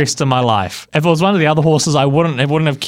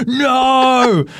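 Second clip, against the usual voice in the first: high, drawn-out shout of panic; long, over-dramatic retold yell; dramatic cry; 11 semitones higher than usual. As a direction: wail it out in mock horror. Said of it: "so dramatic".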